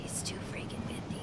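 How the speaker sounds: whispered complaint; whispering. Real, not acted. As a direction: grumble it under your breath. Grumbling at wind.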